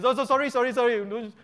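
high, loud mock pleading, fading at the end; hammed-up begging for mercy; begging to Moses. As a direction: grovel to Moses, exaggerated. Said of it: "and then".

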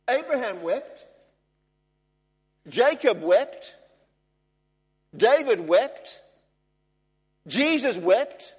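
slow, loud roll call with pauses; emphatic preaching. Real, not acted. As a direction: hammer each name out on its own. Preaches a list of names.

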